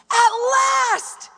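high, loud triumphant shout; big exultant exclamation; exclaimed. Acted. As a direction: cry it out as Adam, drawn from relief. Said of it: "the man exclaimed".

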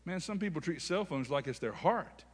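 normal talking voice

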